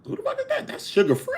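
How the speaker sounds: very high, disbelieving question; stunned high squeak. Retold or imitated. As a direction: squeak it out in shock. Recognizing caller, stunned.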